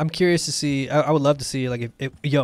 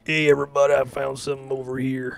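monotone grizzly voice. Retold or imitated. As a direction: drone it in one flat grizzled tone. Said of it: "the one monotone".

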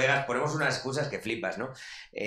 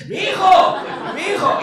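much higher, louder frantic shriek; screaming mother; 9 dB louder, slower than usual. Retold or imitated. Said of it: "Gritaba tanto".

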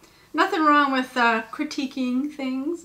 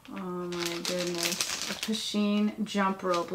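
ordinary delivery

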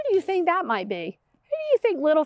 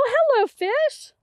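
high, louder delighted hello to a fish; excited greeting; 10 semitones higher, 4 dB louder, slower than usual. Acted. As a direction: greet each fish with wonder.

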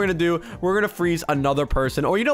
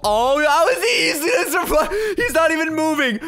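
laughing disbelief; 10 semitones higher than usual. Real, not acted. Gamer laughing as a player survives.

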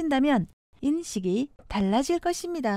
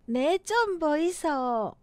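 slow, drawn-out Gyeongsang dialect; 경상도 사투리; slower than usual. Shown as a demonstration. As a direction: stretch it with a Gyeongsang lilt. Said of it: "경상도". (느린 경상도 사투리)

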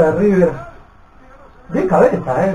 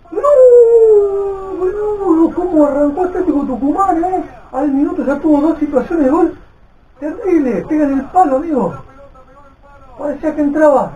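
excited; 13 semitones higher, 4 dB louder than usual. Real, not acted. Fan reacting to early chances.

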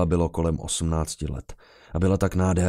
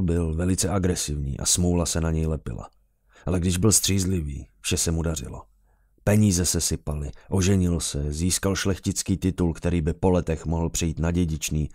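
ordinary delivery

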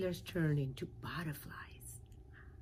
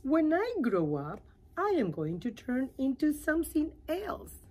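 higher, louder caterpillar voice; little caterpillar voice, eager; 9 semitones higher, 6 dB louder than usual. Acted. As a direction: share a big secret brightly. Caterpillar tells her secret.

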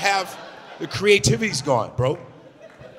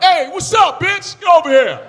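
much higher, louder yelled catcall; old school catcall; 12 semitones higher, 7 dB louder than usual. Retold or imitated. Comic acting out old-school street harassment, loud yell. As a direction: holler across the street at a girl. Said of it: "I'm like".

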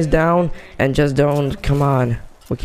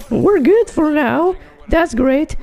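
thrilled celebration. Real, not acted. Celebrating five kills.

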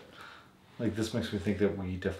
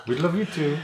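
higher, louder put-on dog voice; voicing the dog; 7 semitones higher, 7 dB louder than usual. Voicing the puppy answering his owner =55. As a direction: speak as the puppy replying. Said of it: "We do love you, puppy".

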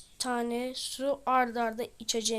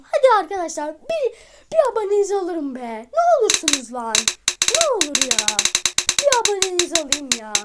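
pleading whine; 2 semitones higher, 8 dB louder than usual. Real, not acted. Begging viewers for subscribers.

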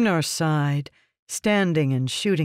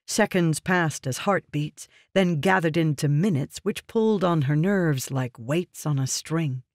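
usual speaking voice

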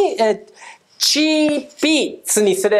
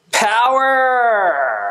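pumped-up signature shout; catchphrase shout. Acted. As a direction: punch it out like a show slogan.

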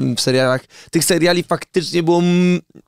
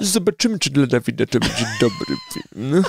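sceptic reaching for wine; skeptical deep voice; 3 dB quieter than usual. Acted. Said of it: "Oh my god, uwielbiam Dawida".

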